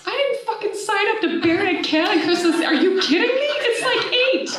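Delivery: very high, incredulous squawking protest; indignant mock outburst. Woman refusing her sister's burial order, comically outraged. Retold protest at being told to bury.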